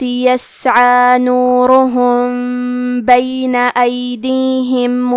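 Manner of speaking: slow, drawn-out melodic tilawat; Quran recitation. Acted. Tajweed teacher recites the verses for students, slow melodic chant.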